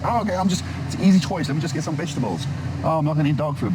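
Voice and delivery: high-pitched mock inner monologue; high, put-on voice of an easily swayed shopper; imagined shopper's voice. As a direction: act out a shopper talking themselves into it, pitched well up. Acts out a shopper's thoughts.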